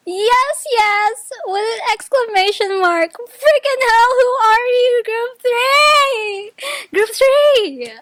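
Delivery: very high, loud, near-screaming joy with laughs; ecstatic shouting. Real, not acted. Reader screaming at group three's bottom card, ecstatic. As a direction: shout it out, overwhelmed with delight.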